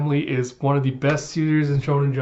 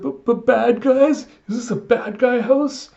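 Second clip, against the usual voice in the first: high, stammering mock panic; alarmed little girl; 10 semitones higher, 3 dB louder than usual. Child realises she is in a bad guy house, alarmed. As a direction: squeak it in childish shock.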